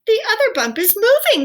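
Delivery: very high, loud cry of alarm; frightened cry. A narrator's reading. Owl crying out as the second bump moves, high panic. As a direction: shriek it out in panic. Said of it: "cried Owl".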